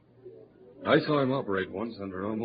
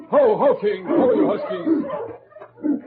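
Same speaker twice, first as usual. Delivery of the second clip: loud, high drawn-out halt call; calling to the dogs; 13 semitones higher, 10 dB louder, much slower than usual. Acted. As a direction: rein in the team with a long shout. Halting the sled dogs.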